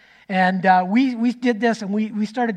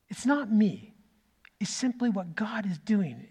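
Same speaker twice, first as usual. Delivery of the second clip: hushed whisper; 6 dB quieter than usual. Real, not acted. Awed by God's work.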